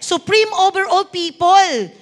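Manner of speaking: insistent, forceful preacher; preaching. Real, not acted. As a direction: drive each declaration home to the congregation. Preaches to congregation. (mariing pangangaral)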